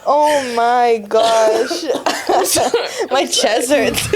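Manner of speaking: real helpless laughter, drawn out and high; cracking up. Real, not acted. Co-host gasping to friends that her chest hurts, laughing. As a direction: recover from laughing so hard it hurts.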